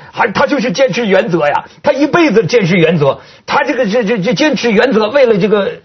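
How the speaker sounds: high, tearful imitation of a grieving man; imitated weeping mourner. North Korean man weeping to the news camera, imitated tearful voice. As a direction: play a sobbing mourner, lightly imitated. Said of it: "就在记者镜头前面就哭啊".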